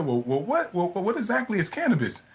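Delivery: mock a baffled grandma fumbling for the question; puzzled grandmother. Grandmother puzzled by unfamiliar word.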